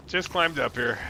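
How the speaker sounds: usual speaking voice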